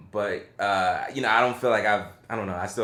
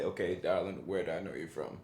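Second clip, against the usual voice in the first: hushed aside to the screen; 5 semitones lower, 12 dB quieter than usual. Retold or imitated. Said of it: "had to pause it immediately and say".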